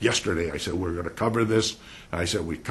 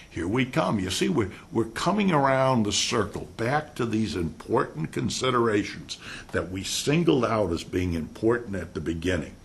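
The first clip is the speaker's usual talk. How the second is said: ordinary delivery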